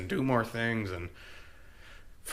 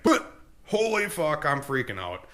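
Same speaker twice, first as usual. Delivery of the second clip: mock panic; 4 semitones higher than usual. Mind panicking far from home.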